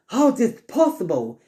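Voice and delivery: high-pitched, astonished question; disbelief. Acted. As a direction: cry out in acted disbelief. Villain baffled by teleporting foe.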